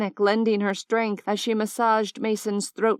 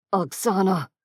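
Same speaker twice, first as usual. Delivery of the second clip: hoarse with pain. Acted. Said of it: "Xander's voice was hoarse with pain".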